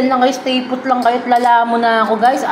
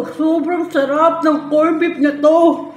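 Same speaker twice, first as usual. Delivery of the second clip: laughing through the words, slow; giggling while praising food; 4 semitones higher, slower than usual. Real, not acted. Tasting, laughing.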